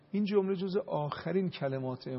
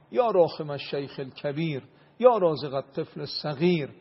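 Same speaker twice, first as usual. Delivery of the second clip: chanted Arabic supplication, growing louder; prayer recitation; 4 dB louder than usual. Acted. Leading the audience in a supplication: chanted prayer. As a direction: intone each plea as a rising prayer.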